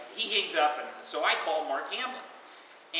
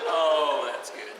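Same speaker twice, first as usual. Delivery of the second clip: laughing; 6 dB louder, much slower than usual. Real, not acted. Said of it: "reacting to joke".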